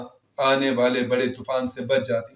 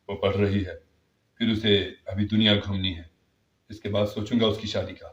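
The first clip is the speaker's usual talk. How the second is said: lower, calm refusal; firm father; 6 semitones lower than usual. Acted. Father to his elder brother, lower firm refusal. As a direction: decline without giving ground. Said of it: "لیکن بابا نے کہا".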